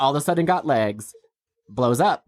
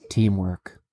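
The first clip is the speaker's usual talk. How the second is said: low, calm mock wisdom; deadpan calm one-word answer; really calm; 5 semitones lower than usual. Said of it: "in a really calm voice".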